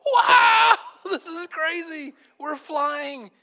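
screamed wow, then high drawn-out wonder; ecstatic amazement. Retold or imitated. Passengers realizing they are flying, wildly amazed. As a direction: shriek like a hammed-up awestruck flyer. Imagined passengers marveling at flight.

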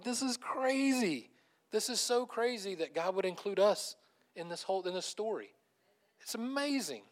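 high, stretched-out exclamations of wonder; amazed disbelief. Retold or imitated. Preacher marveling God would include us, amazed. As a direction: marvel at it, voice lifted.

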